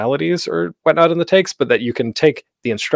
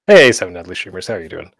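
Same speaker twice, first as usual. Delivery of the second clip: ordinary talk, nothing marked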